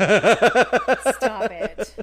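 loud laughing "stop it"; laughing protest. Real, not acted. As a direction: burst out laughing while protesting.

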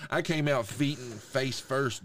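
ordinary talk, nothing marked

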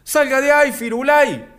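high, loud mock yell from the bench; teammates yelling. Teammates yelling at Facu on the pitch, high mock yell. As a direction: bark it like an angry teammate. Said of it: "tus propios compañeros te gritaban".

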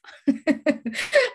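a bright, high burst of laughter; delighted laugh. Real, not acted. Preacher laughing with joy over Allah's mercy, bright laugh.

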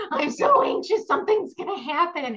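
high, loud, panicky mock wail; imitated anxious sobbing. New mom retelling her sudden postpartum panic, high mock wail. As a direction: replay the new-mom panic attack, half crying. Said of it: "I was just sobbing".

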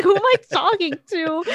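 high, loud, laughing imitation; retold bewilderment. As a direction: mimic a confused man while laughing. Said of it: "he's like".

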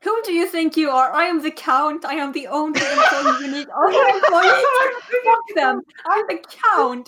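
loud, indignant villain count; raging at the interviewer; angry. Acted. Job applicant erupts as the count.

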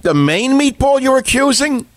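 you can't believe what you just heard; incredulous. Real, not acted. Host reacting to caller's accusation, high incredulous.

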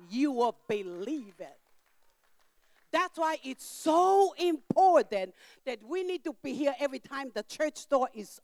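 loud, rising sermon delivery; full-force preaching. Acted. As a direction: drive the warning home to the congregation. Preaching about the enemy's tricks.

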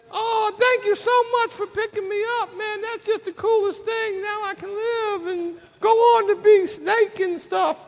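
high, thin put-on snake voice; squeaky, relieved critter; grateful snake. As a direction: gush thanks in a high little character voice. Snake thanks addict in parable.

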